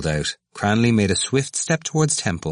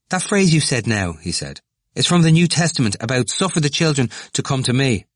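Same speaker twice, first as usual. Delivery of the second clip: high, fast, eager voice; excited young man; 7 semitones higher, 3 dB louder than usual. Acted. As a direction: rush it out, worked up. Temple's excited voice.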